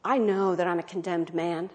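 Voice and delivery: ordinary talk, nothing marked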